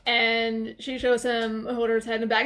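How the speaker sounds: ordinary talk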